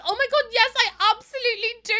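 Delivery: high, loud, thrilled yes from the mum; gushing mum. Retold or imitated. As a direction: squeal it like a delighted mother. Mum accepts son's mock proposal.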